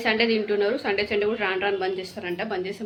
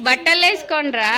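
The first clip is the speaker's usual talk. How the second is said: scolding; 5 semitones higher, 7 dB louder, slower than usual. Real, not acted. Mother scolding kids to dress.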